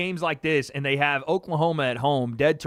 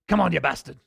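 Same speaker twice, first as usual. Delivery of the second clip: gruff corner-man yell, a touch louder, lightly voiced; John Fury impression; 5 dB louder, faster than usual. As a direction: bark it like a fired-up boxing trainer. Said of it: "That's just my John Fury".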